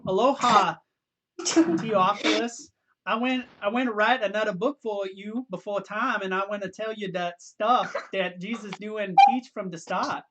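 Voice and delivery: reading aloud in a put-on Hawaiian Pidgin accent; playful island Pidgin accent, a bit Jamaican-sounding. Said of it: "favorite part of that was your accent".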